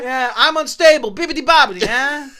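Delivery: high, loud hammed-up Italian accent; goofy Italian accent with a shout. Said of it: "a half Italian accent".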